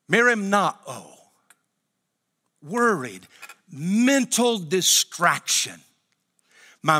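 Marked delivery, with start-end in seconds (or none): none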